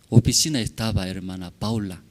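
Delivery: usual speaking voice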